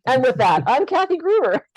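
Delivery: high, loud, laughing through it; laughing sign-off. Real, not acted. Host signing off after the joke, laughing.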